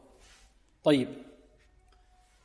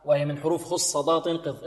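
ordinary delivery

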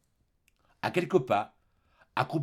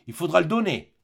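louder, higher insistence; man insisting; 6 semitones higher, 5 dB louder than usual. Acted. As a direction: demand it firmly. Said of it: "repartit la voix d'homme". (Thénardier exige d'une voix forte)

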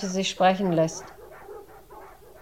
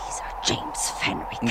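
whispered; 7 semitones lower, 5 dB quieter than usual. Acted. Muttering alone after bad news.

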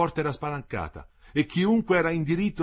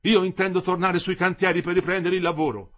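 raised, higher-pitched reply; firm retort; 6 semitones higher, 4 dB louder than usual. A narrator's reading. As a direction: stand your ground out loud. Worker retorts in heated dispute.

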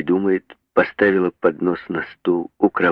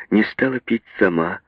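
ordinary delivery

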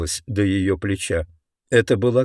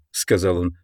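plain reading voice

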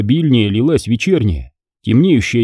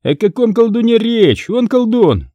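much higher brash retort; brash young Fomka; 11 semitones higher than usual. Acted. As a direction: answer back cheekily like a brash lad.

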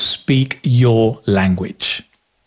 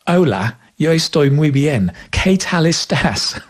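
anglicized Spanish, higher on qué; Spanish the way an English tourist says it; English accent in Spanish; 3 semitones higher than usual. Shown as a demonstration. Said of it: "speak Spanish with the English accent".

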